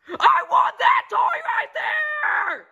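very high, very slow screaming demand; drawn-out shrieking tantrum; tantrum shout. Acted. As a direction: scream it out like a spoiled kid. Kid tantrum peaks.

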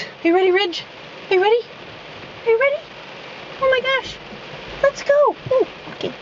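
excited high-pitched urging; excited dog talk. Real, not acted. Hyping up puppy in rain.

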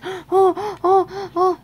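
high, loud worried oh-oh-oh; playful panic; mock alarmed Thomas. Thomas watching Gordon, high playful panic. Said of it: "Thomas was like".

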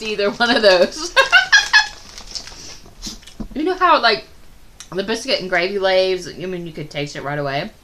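snacking between words; talking while chewing chips. Real, not acted. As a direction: mouth partly full. Seen 0: Holds chip throughout; mouth moves as if chewing between words.

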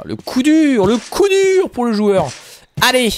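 high, loud mock lament; mock dramatic exclamation. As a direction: wail it like an overblown commentator. Mock dramatic commentary on a setback.

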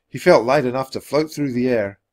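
ordinary delivery, nothing marked

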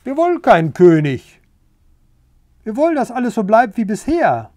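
loud, emphatic group protest; firm refusal. A narrator's reading. Pigs rejecting the rooster as king, loud and firm. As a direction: declare it loudly, punching the words.

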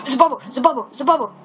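high, urgent repeated cry; excited relief. Real, not acted. Gamer spotting air underwater, excited cry. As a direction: burst out with real excitement. Spots bubble while drowning in game.

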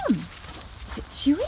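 whispered slowly to the dogs; slow teasing whisper to excited dogs. Real, not acted. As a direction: hush it like a secret. Teasing excited dogs about the box.

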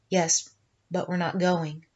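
low and soft, barely above a murmur; a quiet, gentle refusal; said quietly. A narrator's reading. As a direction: say it quietly, settled. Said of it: "he said quietly".

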